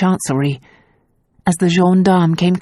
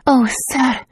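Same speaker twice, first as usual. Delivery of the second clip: put-on much higher pleading girl voice; breathless plea; 8 semitones higher than usual. A narrator's reading. Tazia faking dismay to the gendarme, higher pleading. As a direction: plead with feigned distress. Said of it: "she said breathlessly".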